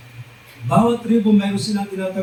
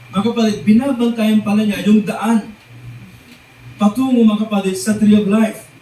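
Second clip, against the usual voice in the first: ordinary delivery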